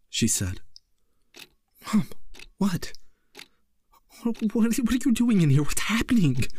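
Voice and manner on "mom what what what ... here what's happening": high, frantic, panicked cry; a terrified son screaming at his mother. A narrator's reading. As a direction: shriek it out, shaking and crying. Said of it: "he screamed, shaking and crying".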